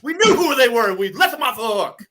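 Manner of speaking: high, loud imitated coach outburst; sound-bite rant. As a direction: shout it like an exasperated coach at a press conference. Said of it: "sound bites from".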